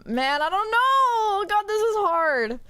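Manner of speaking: loud, high, drawn-out wail of indecision; exasperated wail. Real, not acted. Player stuck on a hard dialogue choice, exasperated wailing. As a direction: wail it out, stretching the words.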